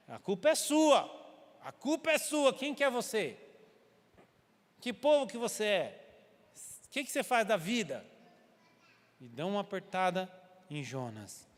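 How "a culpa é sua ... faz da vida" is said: jab every word of the accusation; accusing shout. Retold or imitated. Sailors blaming Jonah for the storm, high and accusing.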